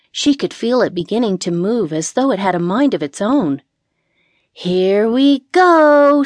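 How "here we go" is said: high, loud, drawn-out call; warning call. A narrator's reading. Witch to cat as broom lifts off, warning call. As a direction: stretch it out, building to lift-off. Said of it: "she warned Kiki".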